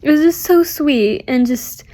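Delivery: ordinary talk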